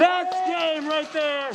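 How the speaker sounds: slow, drawn-out victory shout; barked order. Real, not acted. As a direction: drag it out like a champion. Gloating after win, slow shout.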